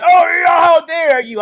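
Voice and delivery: high, loud hammed-up outrage; mock outraged shout. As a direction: shout it like a fake-offended simp. Mocking outraged simps.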